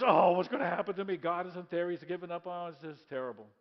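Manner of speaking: loud, hammed-up despair; dramatic lament. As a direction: wail it then let it sink. Joseph voiced despairing.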